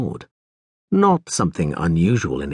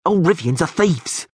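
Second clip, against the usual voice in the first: sneering thug; 8 semitones higher than usual. A narrator's reading. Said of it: "the pockmarked man went on".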